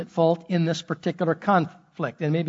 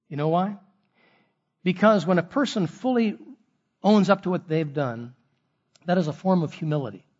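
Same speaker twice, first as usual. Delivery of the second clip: ordinary delivery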